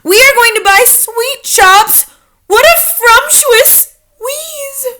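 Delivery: high, loud gleeful witch voices, the end drawn out slowly; high delighted witch cry; excited witches. A narrator's reading. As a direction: gloat with glee like greedy witches. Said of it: "they cried".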